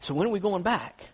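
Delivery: eager, laughing ask; laughing question. Retold or imitated. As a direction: chuckle it out, already itching to return. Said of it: "you're saying".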